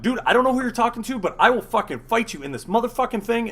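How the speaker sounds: heated, rapid-fire comeback; angry threat. Retold or imitated. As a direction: throw down a quick retold threat. Retold threat in elevator fight.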